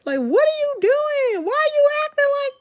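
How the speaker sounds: high, squealed mock protest; exasperated mimicry. Mimicking the woman with the gun in the bank, high exasperated squeal.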